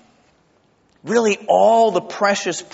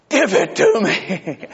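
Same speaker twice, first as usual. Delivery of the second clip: slow, eager mock plea with a laugh; 3 semitones higher, slower than usual. Preacher retelling his eager plea for joy. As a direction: beg for it with a laughing grin.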